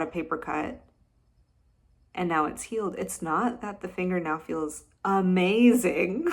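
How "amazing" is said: loud, drawn-out exaggerated delight; playfully over-the-top rapture; mock gushing. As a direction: ham up the amazement. Mimics overblown joy at healed finger.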